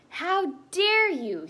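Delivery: high, loud, slow outraged roar; roaring fury. Acted. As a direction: roar it at the whole court in rage. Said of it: "she roared at the court".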